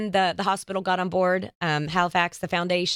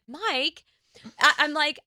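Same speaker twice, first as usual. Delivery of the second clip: higher pitched, passionate pleading; retold plea to husband, pitched up and insistent; earnest insistence; 6 semitones higher than usual. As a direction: convince him, rising with conviction. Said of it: "And I said".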